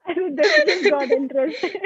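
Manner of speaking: can't stop laughing. Real, not acted. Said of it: "laughing after misreading".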